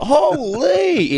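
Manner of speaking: sudden very high squeal of alarm; startled cry as the washer lurches; shocked yelp. Retold or imitated. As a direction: yelp as if chased. Machine chasing them down hallway.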